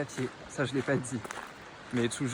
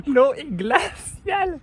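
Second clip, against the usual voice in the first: higher, slow, gasping L'eau est glaciale; drawn-out shivering L'eau est glaciale; in ice cold water; 14 semitones higher, 7 dB louder, much slower than usual. Real, not acted.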